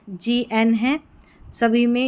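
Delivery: usual speaking voice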